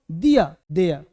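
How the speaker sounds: loud, low "दिया, देयर" without r sounds; "दिया", then "देयर" as "देया", for the class to repeat; correct model for repeating. Shown as a demonstration. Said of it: "बोलो मेरे साथ". (दोहराने के लिए "दिया, देया", र के बिना)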